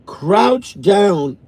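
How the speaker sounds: ordinary delivery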